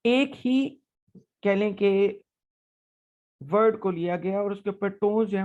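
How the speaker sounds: ordinary talk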